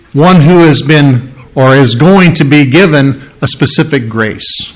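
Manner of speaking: usual speaking voice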